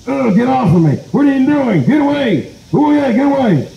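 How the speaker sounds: very high-pitched, indignant squawk; alarmed disgust. Acted. Chief pushing away the kissing possum, alarmed and disgusted. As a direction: fend someone off in a high, flustered voice.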